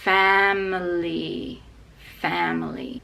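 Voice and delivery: family stressed on fa, middle reduced; family, fa strong. Shown as a demonstration. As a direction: hit fa in family. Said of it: "the stress is on fa".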